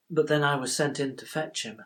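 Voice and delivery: usual speaking voice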